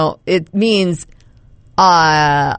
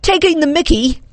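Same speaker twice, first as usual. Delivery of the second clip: high mock British accent; amateur British accent, pitched up; attempted British accent; 7 semitones higher than usual. Shown as a demonstration. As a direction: try the phrase as a would-be Brit. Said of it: "say these all with a British accent".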